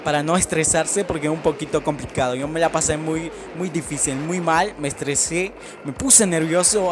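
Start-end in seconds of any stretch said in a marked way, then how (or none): none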